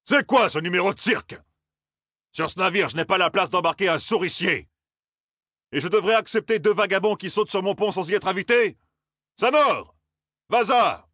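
high, outraged captain's tirade; indignant bawling. A narrator's reading. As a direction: bawl orders at unwanted stowaways. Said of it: "brailla-t-il enfin". (capitaine indigné qui braille)

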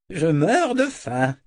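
high, slow, drawn-out exclamation; crying out. Acted. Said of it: "s'écria-t-il". (s'écrier d'une voix traînante)

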